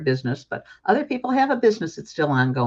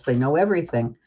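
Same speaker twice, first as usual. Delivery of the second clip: ordinary talk, nothing marked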